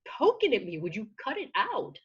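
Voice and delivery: high, swooping telling-off; playfully fed-up protest with big pitch swings; mock exasperation. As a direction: push back at the nagging inner critic, fed up. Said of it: "put them in stark contrast, you go".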